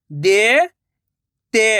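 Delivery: देते drawn out slowly, syllable by syllable; slow syllable split. Shown as a demonstration. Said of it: "मैं किस तरीके से".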